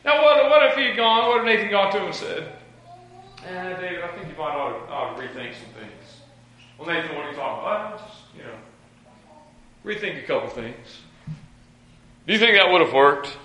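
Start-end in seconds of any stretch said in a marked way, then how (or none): 3.4-11.0 soft, hesitant, half-hearted correction; timid rebuke. Acted. Nathan going easy on King David, timid and soft. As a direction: tiptoe round the reproach, low and quiet.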